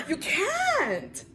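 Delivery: high laugh breaking into words. Real, not acted. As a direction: laugh helplessly then sigh it out. Overwhelmed laugh-cry.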